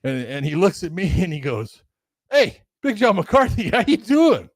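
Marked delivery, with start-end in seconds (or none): 2.3-4.5 cheerful greeting. Retold or imitated. Coach greets him outside restaurant.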